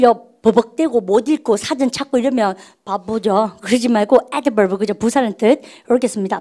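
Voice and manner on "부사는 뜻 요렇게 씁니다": ordinary delivery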